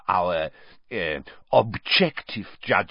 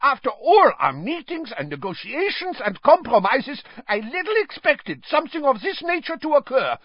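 much higher, louder furious outburst; exploding in anger; 4 dB louder than usual. A narrator's reading. Karkaroff storming at the other heads, exploding. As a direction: blow up and threaten to leave. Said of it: "exploded Karkaroff".

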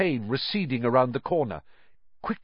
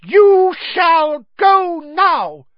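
very loud, slow, high command; fierce elf; 11 dB louder, much slower than usual. A narrator's reading. Dobby orders Malfoy away, high and fierce. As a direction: banish him word by word, shrieking. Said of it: "he said fiercely".

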